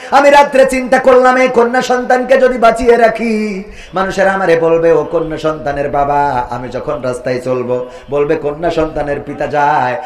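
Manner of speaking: melodic sermon storytelling. A narrator's reading. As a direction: tell the tragic tale in a singsong preacher's cadence. Preacher resumes the chanted tale.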